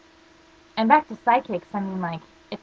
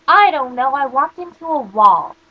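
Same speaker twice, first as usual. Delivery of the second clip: much louder and higher, drawn-out exasperation; exasperated answer; 5 semitones higher, 10 dB louder than usual. Retold or imitated. Patient to doctor who keeps asking why, exasperated. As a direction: blurt it out, fed up with being asked. Patient snaps at repeated question.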